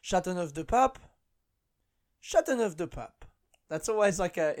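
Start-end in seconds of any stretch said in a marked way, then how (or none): 0.0-1.0 Chateauneuf-du-Pape rising at the end; French metro announcer. Acted. Comedian imitating Paris metro stop announcements, rising announcer. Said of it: "like the ones in France". 2.2-3.2 Chateauneuf-du-Pape starting high, falling away; French metro announcer. Acted. Comedian imitating Paris metro stop announcements, falling announcer. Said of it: "like the ones in France".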